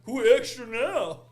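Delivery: loud, high, drawn-out taunt; mock taunt. Teller taunts friend's wife caught in the hail, loud mock gloating. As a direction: throw it back at her, slow and gloating. Throws her line back, mocking.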